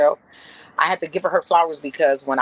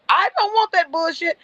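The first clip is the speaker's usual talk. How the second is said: retold indignant refusal; 11 semitones higher than usual. Retold refusal of offensive figurines.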